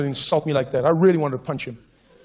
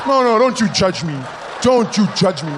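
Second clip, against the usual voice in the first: loud, raised protest; mock defensive; 4 semitones higher, 6 dB louder, slower than usual. Comic scolds the laughing crowd, mock indignant. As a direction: push back hard at the audience, drawing it out.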